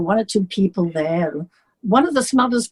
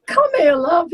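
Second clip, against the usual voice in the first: higher, tender Liverpool welcome; affectionate; 8 semitones higher than usual. Retold or imitated. John comforting the sobbing singer, tender. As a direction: call her in kindly. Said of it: "he said".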